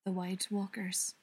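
ordinary delivery

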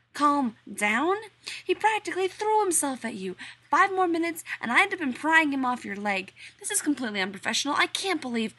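high, loud, rushing outrage; jealous rant. Acted. Rodney ranting to John about the flirting workman, loud and high. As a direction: explode in jealous fury.

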